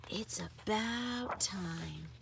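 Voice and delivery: slow breathy murmur. A narrator's reading. Mama at the window murmuring slowly. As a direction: let it slip out slowly under the breath. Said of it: "she murmurs".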